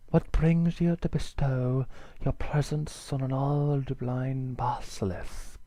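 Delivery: slow, drawn-out snaky basilisk; sinuous accented reptile. Acted. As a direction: stretch the words out like an old snake.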